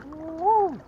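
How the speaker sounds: high, loud whoop of joy; excited whoop. Real, not acted. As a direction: let out a real whoop over the catch. Catching a big crappie.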